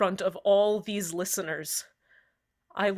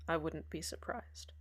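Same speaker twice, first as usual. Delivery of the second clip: much softer, almost gone; fading murmur; 4 semitones lower, 14 dB quieter than usual. Acted. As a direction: let "I wouldn't be surprised" die away. Repeats it, fading to nothing.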